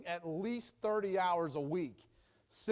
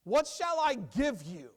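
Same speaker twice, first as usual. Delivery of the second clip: higher, louder, slow eager offer; dramatized offer; 8 semitones higher, 6 dB louder than usual. Acted. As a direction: act out Laban pressing Jacob. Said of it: "Here's Jacob".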